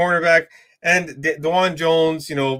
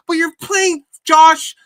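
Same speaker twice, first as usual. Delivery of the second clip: sudden loud, high burst of disbelief; exasperated shout; 10 semitones higher, 4 dB louder, slower than usual. Real, not acted. Ranting at the Ravens coach about punting, exasperated shout. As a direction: yell it like you can't believe the coach.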